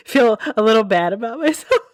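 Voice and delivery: talking through laughter; real laughing. Real, not acted. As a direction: try to get the words out while cracking up. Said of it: "I laughed too hard telling that story".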